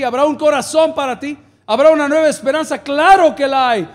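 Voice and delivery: high, loud run of rhetorical questions; full-force preaching. Real, not acted. Pastor promising healing to the congregation, full-force preaching. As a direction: challenge the congregation, building to a loud answer.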